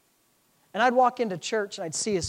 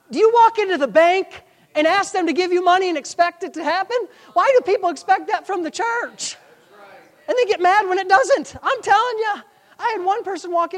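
higher, louder sermon voice; impassioned preaching; 10 semitones higher, 8 dB louder than usual. Acted. Preacher challenges congregation on church money, impassioned preaching. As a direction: drive the point at the congregation.

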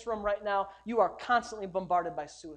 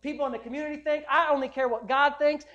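defiant preaching shout; 4 semitones higher, 6 dB louder than usual. Real, not acted. Pastor to congregation, rejecting everyone's judgment, loud defiant shout. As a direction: belt it out like you dare anyone to argue.

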